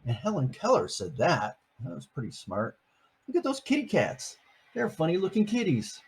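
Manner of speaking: ordinary delivery, nothing marked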